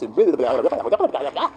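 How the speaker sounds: rapid nonsense babble; mock jabber. Critic mocking art rules at a child, mock jabber. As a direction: blabber on into a garble.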